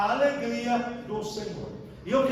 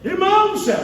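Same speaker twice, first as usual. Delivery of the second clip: excited exclamation; 7 semitones higher, 4 dB louder, faster than usual. Real, not acted. Exclaiming in the sermon.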